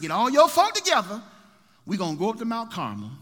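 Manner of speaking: calling out. Acted. Elijah calling the people together again. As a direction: call them together with force.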